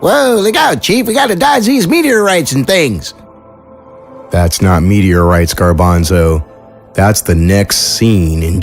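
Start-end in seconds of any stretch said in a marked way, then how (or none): 0.0-3.1 very high-pitched comic voice in a panic; cartoonish sidekick, alarmed. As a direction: play a silly copilot yelping a warning. Sidekick figure shouts a warning.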